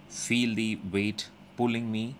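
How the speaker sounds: ordinary delivery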